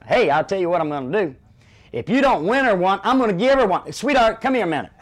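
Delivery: loud, high, quick coaxing shout; barker call-out. Retold or imitated. Barker calling a couple over with a free prize. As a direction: lure the sweetheart over with a loud offer. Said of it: "I say".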